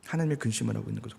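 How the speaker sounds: low, fast, hushed repeat; quick soft echo. Shown as a demonstration. Preacher echoing her point to the congregation, quick soft echo. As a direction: toss it off under the breath. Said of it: "repeated phrase".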